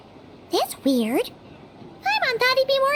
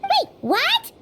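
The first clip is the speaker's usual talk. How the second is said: surprised; 7 semitones higher than usual. Acted. Reacts to unexpected respect.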